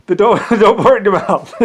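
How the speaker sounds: a joke order through laughter; laughing. Retold or imitated. Boss warns his man not to drink gold, laughing. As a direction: giggle through the warning.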